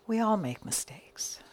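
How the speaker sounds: soft whispered aside; confiding whisper. Acted. Minister admitting to the congregation, soft whisper. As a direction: whisper it like a shared secret.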